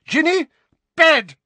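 very high, loud, separate barks; exasperated mother screaming orders; furious shout. A narrator's reading. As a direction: yell the order word by word. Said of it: "shouted Missus Weasley".

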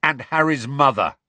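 indignant pupil piling on; mild snarl of grievance; snarled retort. Acted. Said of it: "snarled George".

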